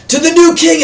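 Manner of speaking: high, loud shouted cry; sudden high yell over the crowd; assassin's battle cry. Acted. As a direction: shout it out as you attack. Assassin shouts, throwing dagger.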